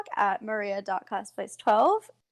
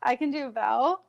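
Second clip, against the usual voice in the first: usual speaking voice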